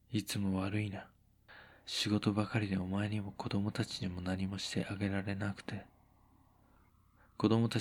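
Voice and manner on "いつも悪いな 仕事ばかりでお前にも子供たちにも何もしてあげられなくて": soft apologetic husband. Acted. Husband apologizing softly to wife.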